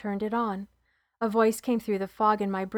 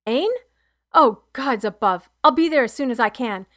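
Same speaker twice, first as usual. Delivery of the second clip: alarmed husband; 3 semitones higher, 9 dB louder than usual. Acted. Mark woken by bad news.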